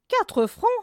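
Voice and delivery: very high, loud indignant squeal; indignant mistress squeaking as she leaves; outraged cry. A narrator's reading. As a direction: fling the price out in disbelief.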